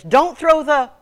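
loud, high cut-off warning; husband's cry broken off mid-sentence; urgent shouted warning. Retold or imitated. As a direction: yell it out to stop them. Husband's warning repeated in story.

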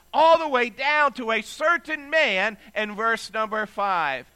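a preacher pressing the point home to the congregation; preaching. Acted. Preaching on God's care for one.

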